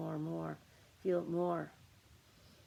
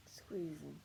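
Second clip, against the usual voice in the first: hushed, effortful whisper; whispered coaching through tensed muscles; 7 dB quieter than usual. Pretend. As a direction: whisper it while squeezing tight. Coaching listeners to squeeze tightly.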